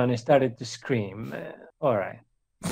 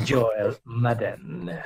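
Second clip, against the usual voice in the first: usual speaking voice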